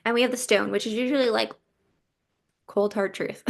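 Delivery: ordinary talk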